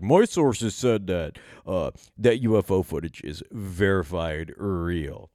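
slow, put-on self-important insider voice; UFO guy impression. Mocking UFO guys who cite their sources. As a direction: drag it out like a smug UFO insider.

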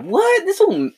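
leaping high, stunned exclamation; shocked what. Real, not acted. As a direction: yelp it in real disbelief.

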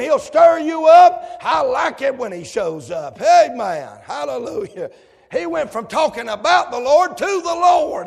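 high, loud, driving preaching; full-force preacher call. Acted. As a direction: whip the congregation up.

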